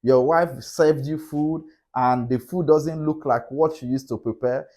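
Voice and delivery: usual speaking voice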